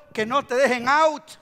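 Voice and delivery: loud, punchy warning; emphatic exhortation. Real, not acted. Preacher has congregation warn each other, emphatic. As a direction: urge it out to the crowd. Exhortation, then repeated softly.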